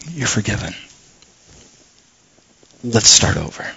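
tender God voice. Retold or imitated. God forgiving a repentant believer, very soft and gentle. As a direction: say it softly, like a reassurance. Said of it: "God will go".

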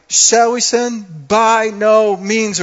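high, loud and slow, each word hammered; upset, stern. Acted. Paul rebuking the church for sinning, slow furious shout. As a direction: thunder it down like an outraged apostle. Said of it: "He is upset".

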